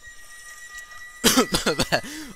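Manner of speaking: sudden coughing fit after swallowing wrong; choking cough. Real, not acted. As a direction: cough hard like something went down the wrong pipe. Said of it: "ich hab mich grad verschluckt".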